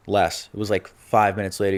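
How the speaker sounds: ordinary talk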